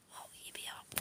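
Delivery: soft, voiceless whisper; whispered thought. Retold or imitated. Thinking to herself about sneaking things past security, whispered. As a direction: mouth it like a sly aside.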